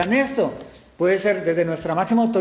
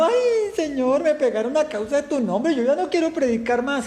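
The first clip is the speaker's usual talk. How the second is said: high, whiny mock crying, much higher voice; hammed-up tearful whine, pitched up; mock whimpering lament; 9 semitones higher than usual. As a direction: play a self-pitying disciple whimpering to the Lord. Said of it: "salieron llorando".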